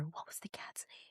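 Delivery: muttering while looking it up; a private whisper while searching; whispered to herself. Real, not acted. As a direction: whisper the question under the breath.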